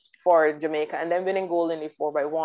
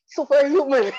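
much higher and louder, in awe; awed exclamation; 9 semitones higher, 7 dB louder than usual. Real, not acted. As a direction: marvel out loud at it. Marvelling at athlete's feat.

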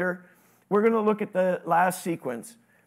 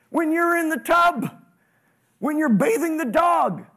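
higher, louder preaching build; preaching at full force; 6 semitones higher, 3 dB louder than usual. Acted. Pastor urging the congregation to stay ready, full force preaching. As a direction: hammer the list home to the crowd.

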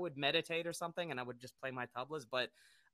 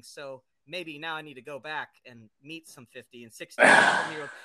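building from plain to loud excitement; rising louder as the realization hits; excited; 13 dB louder than usual. Retold or imitated. Retelling his realization, growing louder.

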